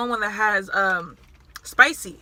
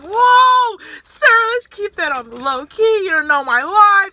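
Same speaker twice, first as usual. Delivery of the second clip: much higher and louder, mock shocked; startled whoa; 7 semitones higher, 7 dB louder than usual. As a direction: brush off the customer's comment, shocked. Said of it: "And I was like".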